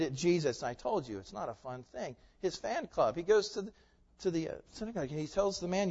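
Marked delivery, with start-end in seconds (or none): none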